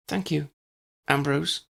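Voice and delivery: high, wan neighbour voice; a lightly voiced tired smile; wan thanks. A narrator's reading. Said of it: "she smiled wanly".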